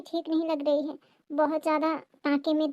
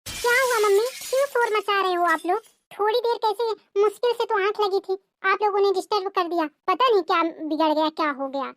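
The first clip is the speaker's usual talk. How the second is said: put-on higher, sharp voice of an irritated young woman; a sister-in-law grumbling at being woken; higher voiced sister-in-law, woken and cross; annoyed sister-in-law; 5 semitones higher, 3 dB louder than usual.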